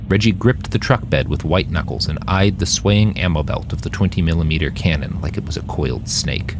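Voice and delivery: plain reading voice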